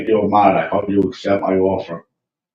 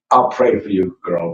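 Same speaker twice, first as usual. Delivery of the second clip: ordinary talk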